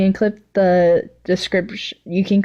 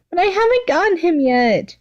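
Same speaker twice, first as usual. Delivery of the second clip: whiny plea; 11 semitones higher than usual. Acted. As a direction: beg like a spoiled child. Toga voiced in read story.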